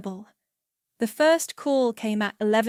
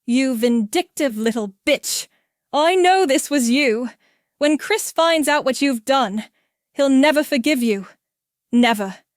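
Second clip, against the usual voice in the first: loud, higher, panicked rage; shrill panic; 3 semitones higher, 7 dB louder than usual. Acted. Mother-in-law Sandra raging at Ciara by voicemail, shrill and loud. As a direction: spit the words in a frenzy. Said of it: "her voice shrill with panic".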